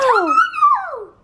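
very high, slow, stretched-out call; singsong echo. Pretend. Child echoing mom's see you tomorrow, high singsong. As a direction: hold the word long and high, playfully.

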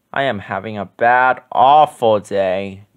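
droop through awful day; woeful Grover, understated; gloomy Grover. A narrator's reading. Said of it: "said Grover".

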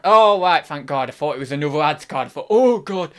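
ordinary talk, nothing marked

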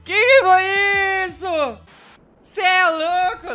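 loud, high astonished yelling; shocked shout. Real, not acted. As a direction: shout in total disbelief. Shocked by sudden game event.

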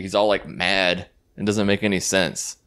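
ordinary talk

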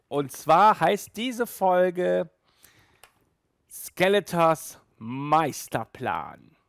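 slow, drawn-out announcement of the title; dramatic title reveal. Acted. Host revealing the episode title to viewers, slow and dramatic. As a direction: build suspense before the episode name.

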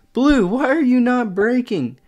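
frustrated shouting at the board; exasperated rant. Real, not acted. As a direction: yell at each player in turn. Berating players one by one.

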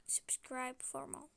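breathy whispered sign-off. Acted. Child whispers subscribe request to viewers, whispering.

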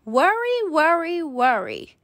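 lightly voiced grandmother, falling from high. A narrator's reading. Grandmother chiding Wimberly about worrying, falling sing-song. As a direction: tut each worry, stepping down. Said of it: "said her grandmother".

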